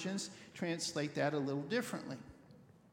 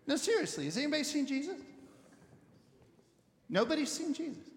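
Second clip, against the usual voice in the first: rising alarm; 6 semitones higher, faster than usual. Retold or imitated. Parents' growing alarm.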